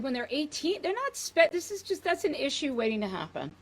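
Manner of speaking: a mother protesting, voice climbing high. Retold or imitated. Mother protests husband about sleepover, high flustered voice. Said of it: "And I'm like".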